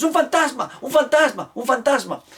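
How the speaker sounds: high panicked shrieks, fading toward the end; yelling like madmen. Retold or imitated. Disciples seeing the risen Jesus, repeated high terrified yells. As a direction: cry out in terror over and over. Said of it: "a gritar como locos".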